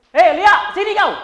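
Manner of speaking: loud, high shout across the room; loud Medan call. Shown as a demonstration. Trainer demos a Medan speaker calling Lia, shouting. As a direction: yell it Medan style, full force. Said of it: "Aku dari Medan, ngomong aku kenceng ini".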